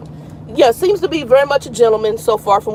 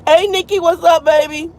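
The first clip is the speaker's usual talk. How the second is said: ordinary talk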